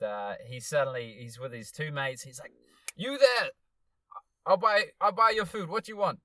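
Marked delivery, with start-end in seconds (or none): 3.0-6.2 high, loud mock Zimbabwean accent. Stranger offering to buy everyone food, loud Zimbabwean accent. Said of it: "that's how Zimbabwe sound".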